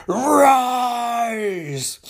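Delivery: long, high, loud drawn-out command; commanding cry. Acted. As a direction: summon the dead with one long cry. Villain commands Necron to rise.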